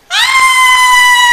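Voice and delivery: shrill shocked scream, very high. Acted. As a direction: scream in fright at the car. Character screams at car.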